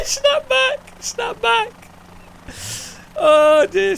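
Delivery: viel höher und lauter, unter Tränen lachend; helpless laughter. Real, not acted. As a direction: lach dich kaputt beim Reden. Hysterical laughing at broken elbow.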